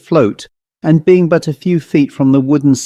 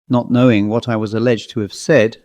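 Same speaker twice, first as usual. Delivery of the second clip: ordinary delivery, nothing marked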